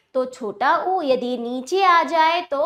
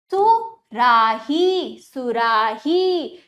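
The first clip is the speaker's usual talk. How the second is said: सुराही very slow, split then blended; drawn-out classroom drill on सुराही; broken, joined pronunciation; 4 dB louder, much slower than usual. Shown as a demonstration. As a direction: stretch each syllable of सुराही, then join it. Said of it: "तोड़कर जोड़कर उच्चारण".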